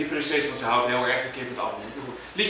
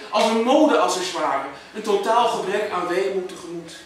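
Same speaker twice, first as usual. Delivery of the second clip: higher, emphatic declaiming; exasperated poem recital; 7 semitones higher, 4 dB louder than usual. Acted. Poet cursing the eternal pergola to an audience, exasperated recital.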